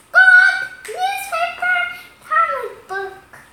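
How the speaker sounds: loud, high parrot squawk. Acted. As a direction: screech the word back like a parrot. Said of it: "Polly repeats".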